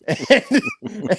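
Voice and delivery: high squealing laugh at his own story; burst of laughter. Real, not acted. Telling a wild story, high laughing burst.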